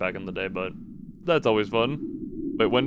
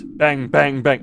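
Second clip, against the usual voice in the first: mock gunshots; 3 semitones higher, 8 dB louder, much slower than usual. Shown as a demonstration. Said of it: "that's the bang, bang, bang I read earlier".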